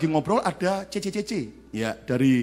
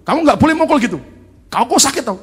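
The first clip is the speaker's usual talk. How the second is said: higher, louder scolding; 10 semitones higher, 8 dB louder, faster than usual. Retold or imitated. Father scolding his daughter for hitting her brother. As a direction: tell her off firmly.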